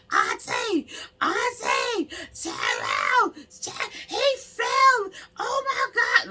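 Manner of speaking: screamed imitation of panic, very high and loud; frantic niece tripping. Niece screaming to her aunt that he fell, frantic. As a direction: shriek in alarm, voice shooting way up. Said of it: "When I said she was tripping".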